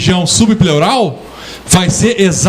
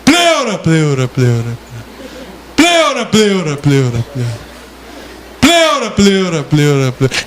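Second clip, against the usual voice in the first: fading echo; 3 semitones lower than usual. Shown as a demonstration. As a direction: echo bouncing back and fading. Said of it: "vou escutar". (eco da pleura sumindo)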